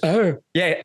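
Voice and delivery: lightly imitated movie-star drawl; Nicolas Cage. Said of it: "And he goes".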